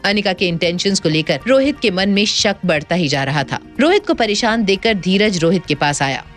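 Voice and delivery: ordinary talk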